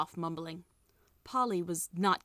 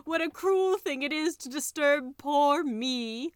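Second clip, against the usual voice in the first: slow, high, self-pitying wail; wailing tiger skin; 7 semitones higher, 5 dB louder, slower than usual. A narrator's reading. Tiger skin complains at being disturbed, wailing. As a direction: draw it out high and loud. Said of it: "it wailed".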